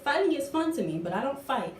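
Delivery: ordinary talk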